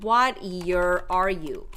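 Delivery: very slow, clear classroom question; teacher reading the question at half speed; slow model question. Shown as a demonstration. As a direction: model the question slowly for learners. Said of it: "you can also ask as a question".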